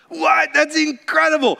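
incredulous exclamation; astonished. Real, not acted. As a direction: sell the amazement. Preacher marvels at miracle.

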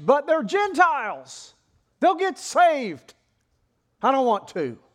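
loud, high, drawn-out complaint; acted reluctant protest. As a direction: object loudly like a balking prophet. Jonah protesting to God.

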